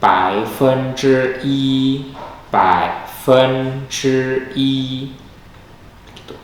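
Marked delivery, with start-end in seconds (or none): 0.0-4.7 bai fen zhi i said very slowly, the last i kept level; model bai fen zhi i. Shown as a demonstration. Teacher models baifenzhi yi for students, very slowly. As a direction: space out each syllable, i flat. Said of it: "I-nya di belakang, dia tetap".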